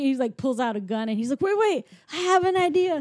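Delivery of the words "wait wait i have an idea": high, excited mock urgency; sudden idea. Retelling the fugitive's sudden plan, mock excited. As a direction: blurt it like a bright idea.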